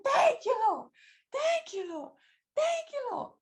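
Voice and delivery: very high, crying thank you Lord; tearful thanks. Retold or imitated. Thanking God through tears, very high and crying. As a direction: wail it to God, overcome. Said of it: "I started bawling, crying, saying".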